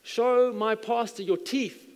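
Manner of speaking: high, loud call across the yard; sick friend calls to the pool guy outside, loud and high; retold shout. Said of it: "he shouted".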